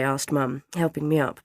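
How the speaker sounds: ordinary delivery, nothing marked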